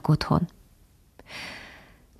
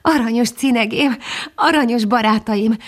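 almost crying; 6 semitones higher than usual. A narrator's reading. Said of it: "szinte sírva a meghatottságtól".

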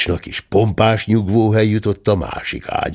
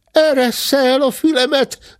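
much higher squealing cub voice; shrill screech; 4 dB louder than usual. A narrator's reading. The cub to the squire holding his ear, screeching. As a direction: screech in pain and protest. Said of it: "rikácsolta a bocs".

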